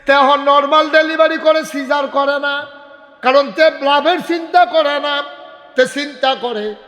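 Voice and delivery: high ringing sermon cry; full-force preaching. Acted. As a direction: belt it out to the crowd, stretching the phrases.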